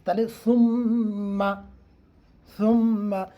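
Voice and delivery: slow সুম্মা with a soft lisped s; soft summa. Shown as a demonstration. Teacher repeats the right সুম্মা for students. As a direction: draw out সুম্মা gently. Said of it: "সাটা নরম করে".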